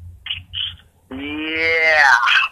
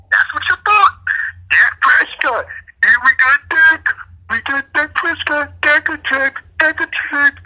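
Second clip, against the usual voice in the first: gloating mimicry of Dak hype, pitched way up; mocking fans; 12 semitones higher than usual. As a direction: taunt the rival fans in a high mock voice. Mocking Cowboys fans' hype.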